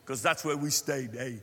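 joking Afrikaans-accented English; playful Afrikaner accent; South African accent. Shown as a demonstration. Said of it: "that's a South African accent".

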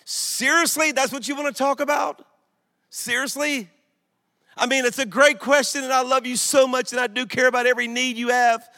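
a loving father begging you to help find his lost son; exasperated Father. Pretend. God answers his children's small prayers, exasperated and pleading to find the lost son. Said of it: "what our prayers might sound like to the Father".

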